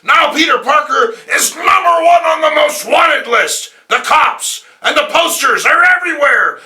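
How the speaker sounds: high, loud blustering boss shout; yelling editor. Acted. J. Jonah Jameson yelling at Robbie about Parker. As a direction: bellow at the staff in a rage.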